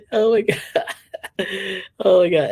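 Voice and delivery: loud, laughing disbelief; laughing oh my God. Real, not acted. Vlogger laughing at Pam teasing Fi. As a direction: crack up at the teasing.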